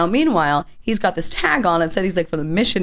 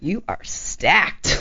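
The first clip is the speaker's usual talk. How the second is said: slow come-on line; 4 semitones higher, much slower than usual. Retold or imitated. Imitating the man's come-on.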